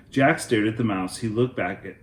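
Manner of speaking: ordinary delivery, nothing marked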